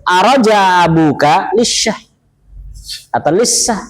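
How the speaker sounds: slow, voiced colloquial Arabic quote "Araja abuka lisyah"; dialect Arabic. Shown as a demonstration. Teacher contrasting colloquial Arabic, slowly. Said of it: "di sana ada bahasa amiyahnya".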